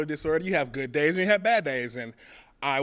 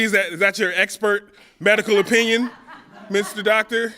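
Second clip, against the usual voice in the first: mock-incredulous, higher and louder, with a laugh; sarcastic retort; 6 semitones higher, 6 dB louder than usual. Comic mocking the Navy doctor's diagnosis, sarcastic and laughing.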